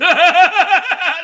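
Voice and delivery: loud, high delighted laugh; big giddy laugh; high burst of laughter. Real, not acted. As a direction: cackle with glee.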